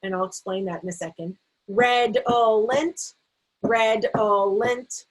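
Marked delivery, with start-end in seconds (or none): 1.7-5.0 redolent chanted slowly in three beats; slow, evenly separated syllables; tapped-out syllables. Shown as a demonstration. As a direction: tap out red-ol-ent each time. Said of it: "tap the syllables".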